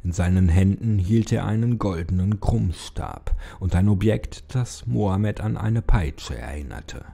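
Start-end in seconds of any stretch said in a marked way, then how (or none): none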